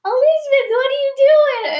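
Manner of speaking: high, loud sing-song mom question; imitated mom. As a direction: call out like mom to a baby. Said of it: "my mom would go".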